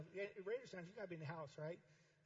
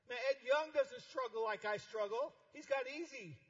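mock envy; 5 semitones higher, 8 dB louder than usual. Voicing envious pastor.

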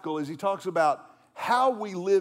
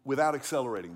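ordinary talk, nothing marked

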